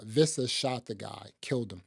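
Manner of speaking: normal talking voice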